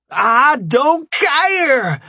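slow, high, loud scream; staged rage. A narrator's reading. Rambo faking a fight, slow furious scream. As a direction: scream in mock fury. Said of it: "Rambo screamed in rage".

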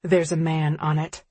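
ordinary delivery

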